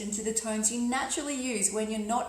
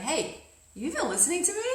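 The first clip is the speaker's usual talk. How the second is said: higher-pitched check for attention, rising at end; questioning listener; 6 semitones higher than usual. Acted. Speaker to someone looking away, questioning and higher. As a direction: call them back with a lifted question. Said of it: "and you're like".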